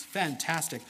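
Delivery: ordinary delivery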